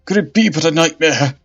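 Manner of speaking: loud, high desperate pleading; cling to it being a dream; frantic denial. Acted. Bishop denies reality.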